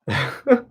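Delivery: brief, light laugh; a quick chuckle to himself; short chuckle. Real, not acted. Host checking the stream's audio, short chuckle.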